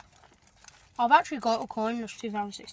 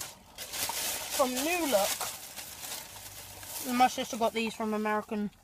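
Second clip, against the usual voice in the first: talking around a sour candy; words round a candy in the mouth; eating sour candy; 6 dB quieter than usual. Real, not acted. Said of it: "it's still in my mouth".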